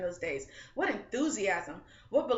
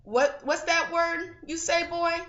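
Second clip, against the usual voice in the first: higher, louder, slowed jeering shout; mocking yell; 9 semitones higher, 6 dB louder, slower than usual. A narrator's reading. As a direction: yell it down at him from the crowd. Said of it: "and they yelled".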